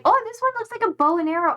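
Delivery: high fast excited discovery. Real, not acted. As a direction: gush over the icon. Excited spotting icons.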